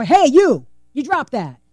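loud "Hey, you" called out, then back to normal volume; punched, swooping "hey, you"; different inflection. Shown as a demonstration. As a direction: call out to get attention, then ease off. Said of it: "how voice inflection can matter".

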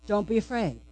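quiet, gentle, reassuring; soft reassurance. Acted. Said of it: "The words are this".

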